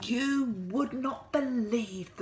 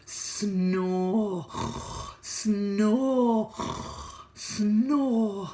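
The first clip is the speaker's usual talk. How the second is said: loud snores; big sleepy snores; snoring; 3 semitones higher, 5 dB louder, much slower than usual. A narrator's reading. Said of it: "Snore went Missus Bear".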